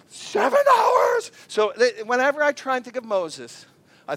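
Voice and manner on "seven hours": high, slow, loud disbelieving cry; outraged shout. Retold or imitated. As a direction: wail it high and long. Kids' outraged cry at trip length.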